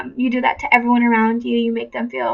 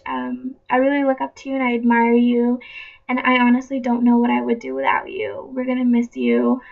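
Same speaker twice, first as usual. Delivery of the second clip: usual speaking voice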